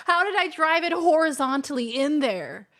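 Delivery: ordinary talk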